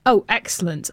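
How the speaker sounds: ordinary delivery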